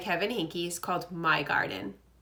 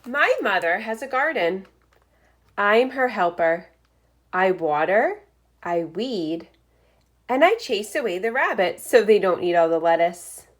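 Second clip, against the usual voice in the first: lively picture-book read-aloud; reading to children; 6 dB louder than usual. Acted. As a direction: read it like story time for first graders. Reads picture book aloud to class.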